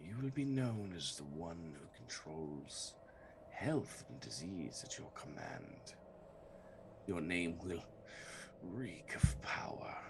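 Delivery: soft eerie whispered queen voice; sickly sweet whisper. Acted. Plague queen voice continues.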